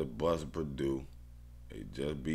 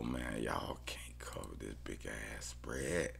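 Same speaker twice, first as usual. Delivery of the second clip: much softer, breathy; whisper; 6 semitones lower, 9 dB quieter than usual. Real, not acted. Bettor doubting Toledo can cover, soft whisper. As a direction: hush the doubt.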